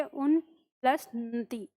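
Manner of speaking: उन plus नति spoken slowly in two parts; slow breakdown. Shown as a demonstration. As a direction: spell out उन plus नति piece by piece. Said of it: "दो टुकड़ों में याद करेंगे". (उन प्लस नति को धीरे, दो हिस्सों में)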